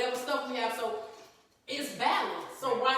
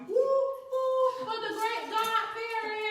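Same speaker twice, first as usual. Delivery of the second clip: high, whooping mock celebration; high-pitched hooray, drawn out; playful cheer; 10 semitones higher, slower than usual. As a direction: whoop it up for the honoree. Cheering herself as godparent.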